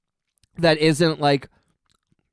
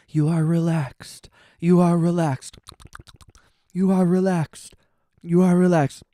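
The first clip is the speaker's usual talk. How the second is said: hypnotic "You are relaxed", a playful parody; mock ASMR voice. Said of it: "it's A S M R".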